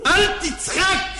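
high, slow shout; angry shout. Acted. To the laughing killer, a high slow angry shout.

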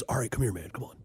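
lightly imitated cartoon devil, talking quickly; Satan from South Park. Haunted house guy coaxing a scared kid, Satan impression. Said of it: "he sounds like Satan from South Park".

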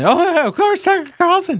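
very high-pitched mocking caricature of a columnist; Philip Bump impression. Host mocking a columnist's dismissive reply, high squeaky impression. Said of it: "that's my Philip Bump impression".

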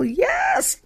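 a high, sudden shouted yes; exultant shout. Real, not acted. The preacher confirms her trials to the congregation with a shout. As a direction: burst out with a cry of yes.

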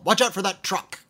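mock yell at a driver; playful warning yell. Playfully freaking out a driver, mild mock yell. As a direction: yell it lightly, as a playful false alarm. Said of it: "I will often yell".

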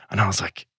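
usual speaking voice

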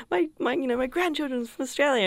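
a hint of a grandfather telling the locals; grandfather's voice. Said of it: "my grandfather would always be like".

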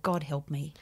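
flat-sounding dread about TikTok; deadpan dread. Real, not acted. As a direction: say it like you dread it. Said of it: "despite how excited she sounded about it".

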